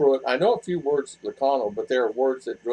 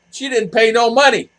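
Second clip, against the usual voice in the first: higher, louder mock outrage; retold realization; 8 semitones higher, 7 dB louder than usual. Realizing the daughter tricked him, retold indignant exclamation. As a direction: exclaim it as it dawns on you.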